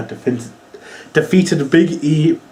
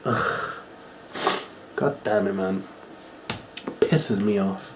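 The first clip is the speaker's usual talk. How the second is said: exasperated mutter; 6 semitones lower, 12 dB quieter than usual. Real, not acted. Frustrated grumble after rant.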